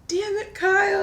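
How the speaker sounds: high squeaky mock groan at the friend; mock exasperated curse. Mock cursing at friend's advice.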